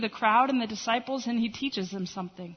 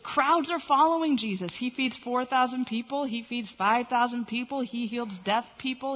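normal talking voice

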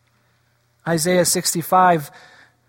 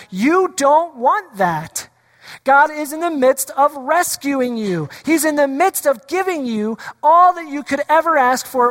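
higher, earnest Moses voice; pleading Moses; 6 semitones higher than usual. Retold or imitated. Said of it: "being the voice of God to the people".

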